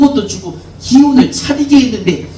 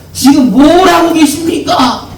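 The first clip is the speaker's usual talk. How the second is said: rebuking greeting; 8 semitones higher, 5 dB louder than usual. Shown as a demonstration. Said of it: "인사합시다".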